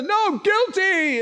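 high, drawn-out cry against the accused; shouted accusation. Acted. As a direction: yell the verdict back, slow and high. Acting out the opposing shouts.

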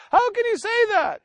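high-pitched imitated indignation; mock outrage. Preacher imitating relative shocked about mom, high and indignant. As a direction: squeal it up as an appalled relative.

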